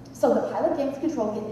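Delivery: ordinary talk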